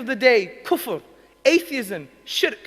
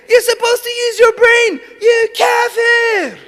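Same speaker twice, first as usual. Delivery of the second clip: aggressive ranting; 8 semitones higher, 9 dB louder than usual. Retold or imitated. Young convert yelling at his dad to take Islam, furious shout. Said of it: "aggressive, crazy kind of guy".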